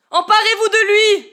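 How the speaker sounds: very high, loud command; shouted order. Acted. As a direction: bark the order to seize him. Les aveugles lancent l'ordre. (ordre crié très fort et aigu)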